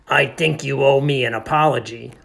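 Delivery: loud, put-out workman voice; Milo the janitor. Acted. Janitor demands an apology from the narrator, indignant. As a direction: demand it with wounded pride.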